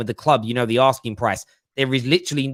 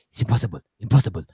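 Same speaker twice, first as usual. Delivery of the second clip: quick, intense half-whispered Spanish-accented impression; hammed-up Pep, urgent but hushed, fast; Pep Guardiola whisper-shout; 4 semitones lower, much faster than usual. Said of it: "he shouts like he's whispering".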